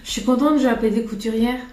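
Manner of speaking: normal talking voice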